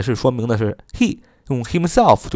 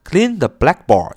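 slow teaching read; 4 semitones higher, slower than usual. Shown as a demonstration. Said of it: "就是擦黑板".

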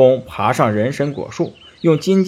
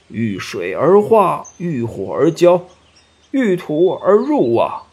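storybook local deity explaining, slowing right down; slow, drawn-out recital by a folk-tale god; earth god; 4 semitones higher than usual. A narrator's reading. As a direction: intone each rule slowly in a put-on character voice. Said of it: "土地急忙喊冤，并说".

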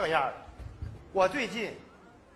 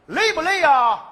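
loud, higher mock-sweet boss; mock boss asking; 6 semitones higher, 10 dB louder, slower than usual. Fourth boss asks about tiredness, loud and high. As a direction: chirp it like a fake-kind boss. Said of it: "我就冲着他笑".

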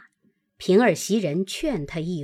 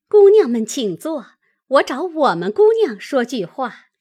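lightly voiced social politeness, higher and louder; a louder, raised polite greeting; polite sister-in-law; 5 semitones higher, 7 dB louder than usual. A narrator's reading. Sister-in-law asking the maids to sit, politely eager.

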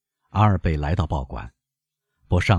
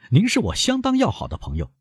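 sneering, rising on 要, pitched higher; curled lip. A narrator's reading. Albert repeats to Beauchamp with a sneer, scornful rising tone. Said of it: "撇起嘴唇又说".

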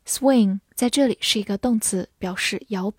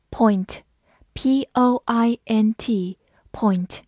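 measured, well-spaced dictation of a word; slow spelling aloud; much slower than usual. Shown as a demonstration. Teacher introduces a new word to learners, spelled out slowly.

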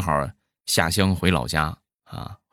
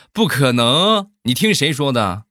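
heated retort; 8 semitones higher, 5 dB louder than usual. Acted. Heated argument in acted quote.

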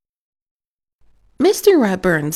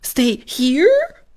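higher and louder disbelieving "here?" rising; shocked echo; 8 semitones higher, 3 dB louder than usual. Acted. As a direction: repeat it as if you misheard. Arthur echoes in disbelief.

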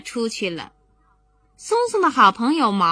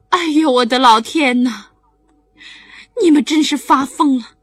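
louder, exasperated exclamation; alarmed mother; 3 semitones higher, 7 dB louder than usual. Acted. Mother scolding the children's crazy idea, loud dismay. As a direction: throw up your hands as the mom.

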